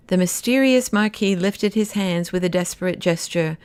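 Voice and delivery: ordinary delivery, nothing marked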